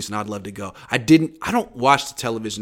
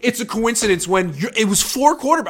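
loud, raised-pitch challenge to the coach; bold reporter challenge; 10 semitones higher, 7 dB louder than usual. Retold or imitated. Reporter confronting Kyle at the press conference, loud and high. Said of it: "freaking Jason at the end was like".

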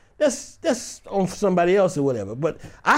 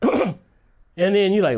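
ordinary talk, nothing marked

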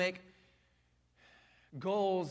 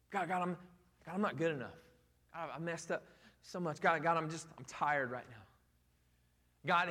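mock weary excuse; 8 semitones lower, faster than usual.

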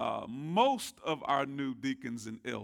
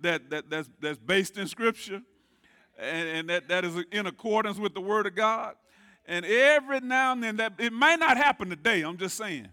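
loud, high-pitched preacher cadence; preaching at full force; 7 semitones higher, 9 dB louder than usual. Real, not acted. Pastor urging the congregation to answer amen, preaching loud. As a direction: swing into full preaching fervor, fishing for an amen.

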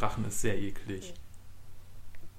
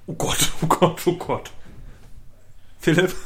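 mock panic; 5 semitones higher, 9 dB louder than usual. Mock panic about hospital.

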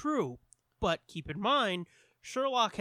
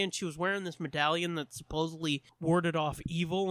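usual speaking voice

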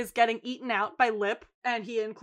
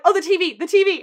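high, loud panicky cries; mock alarm; 6 semitones higher, 9 dB louder, faster than usual. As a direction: imitate frantic worry for a precious object. Retold alarm for the TV.

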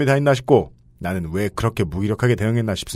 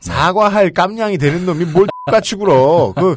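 mock indignation; 5 semitones higher, 4 dB louder than usual. Acting out the letter writer's indignation.

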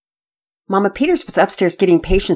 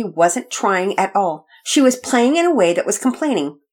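put-on boy's version; 6 semitones higher than usual. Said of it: "launched into his version of what was happening".

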